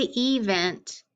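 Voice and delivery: "event" said as EE-vent; long E mispronunciation. Shown as a demonstration. Teacher rejecting the wrong reading for students, long-E "event". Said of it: "No, we don't say event".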